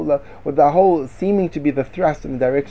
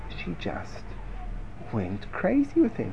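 gossipy hush; 13 dB quieter, slower than usual. Acted. Aunt gossiping at the hairdresser's.

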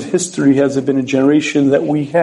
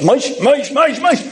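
each Moshe climbs higher; rising exasperation; 9 semitones higher, slower than usual. Acted. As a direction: call the boy's name, more fed up each time. Rebbe losing patience with pupil.

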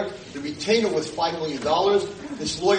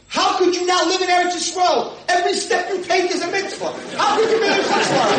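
high, loud shouted tirade; imitated screaming; 8 dB louder than usual. Former student berates rabbi before everyone, screaming. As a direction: scream it as an outraged rebuke. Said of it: "She starts screaming".

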